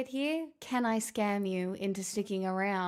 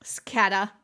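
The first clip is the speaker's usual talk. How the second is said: normal talking voice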